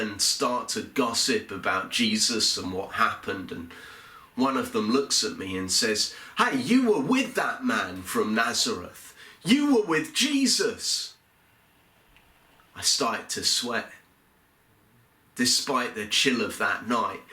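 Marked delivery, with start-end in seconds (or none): 6.4-11.1 sharp accusation, climbing much higher on the last line; accusing serving woman. Acted. Serving woman accusing Peter by the fire, rising high accusation. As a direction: point the finger and call him out, rising shrill on Jesus.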